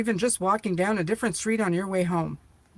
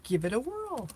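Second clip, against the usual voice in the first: higher and softer, cutesy; 5 semitones higher, 6 dB quieter than usual. Pretend. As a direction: coo it like talking to a pet. Repeat in cute voice.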